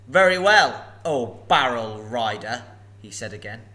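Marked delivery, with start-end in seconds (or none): 0.1-2.6 loud, slow, looming dragon voice; a huge dragon toying with a thief; booming sly dragon; menacing dragon. Acted. Dragon speaks.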